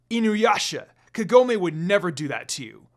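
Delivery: loud, firm rebuke; firm declaration. A narrator's reading. Moroku defending Kagome against Inuyasha, loud and firm. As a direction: stand up to him forcefully. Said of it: "Moroku declared, arguing with him".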